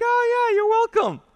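very high, loud mock-cheery reply; hammed-up polite acknowledgement; playing along. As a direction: answer the fake child in falsetto. Plays along with mom's pretence.

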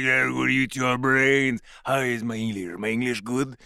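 a French zombie, shy about his English; lightly voiced French zombie accent. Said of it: "he was like".

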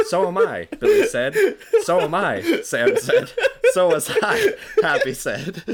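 high, loud, laughing through it; can't keep a straight face; reading through giggles. Real, not acted. Reading while cracking up.

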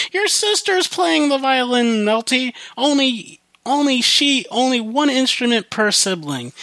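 high-pitched mock nagging; mock-exasperated high voice; retold scolding. Retold parent refusing the violin.

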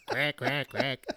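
laughing mock duck quacks. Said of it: "yours sounded like a duck".

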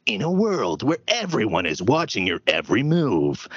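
trained trailer announcer from the back of the throat; dramatic, throaty movie trailer voice; movie guy voice. Shown as a demonstration. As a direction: intone it like a movie trailer narrator. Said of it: "that is at the back of my throat".

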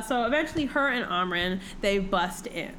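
usual speaking voice

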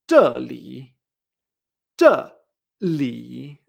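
"zheli" with a loud low drop, then a dip that turns up; dry fall, curved tone. Shown as a demonstration. Teacher modelling here, "zheli" falling then curving. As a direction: hit "zhe" falling, curve through "li". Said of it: "baja y hace este tono curvo".

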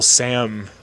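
ordinary talk, nothing marked

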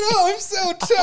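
high, slow mock lament; hammed-up high-pitched teasing voice; mock tall girl. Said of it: "He said".

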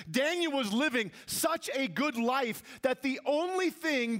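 high-pitched, emphatic sermon delivery; impassioned preaching. Real, not acted. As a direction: preach it hard, landing on each key word. Preaching at full force.

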